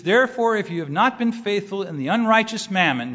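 scripture reading. Acted. Reads scripture aloud.

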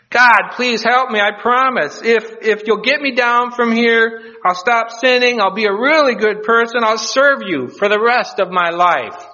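high-pitched, urgent begging; hammed-up plea from a man in peril; desperate pleading. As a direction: bargain frantically for your life. Cliff hanger begs God for rescue.

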